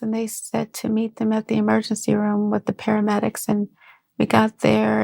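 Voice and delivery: ordinary delivery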